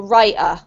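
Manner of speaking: writer said London-style, WRI'-uh; glottal stop. Shown as a demonstration. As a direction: replace the T in writer with a throat catch. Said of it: "a glottal stop".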